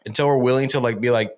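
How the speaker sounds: normal talking voice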